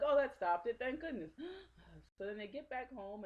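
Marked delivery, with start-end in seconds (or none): 0.0-1.7 high, loud imitated relief ending in a gasp; mock relief. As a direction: play exaggerated relief, then gasp. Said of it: "and they're like".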